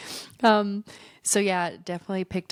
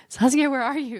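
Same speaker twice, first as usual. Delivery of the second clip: calling out loudly, pitched up; 8 semitones higher, 5 dB louder than usual. Retold or imitated. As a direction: call across the house for a friend. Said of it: "my friend was like".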